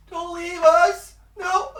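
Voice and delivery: put-on very high, loud wailing child; whiny child ghost. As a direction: wail like an abandoned little boy. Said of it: "whiny voice from directly in front of you goes".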